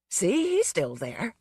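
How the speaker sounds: slow, gentle humouring tone; calm her down slowly; soothingly. Acted. Policeman humouring the upset girl, slow soothing voice. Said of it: "her captor said soothingly".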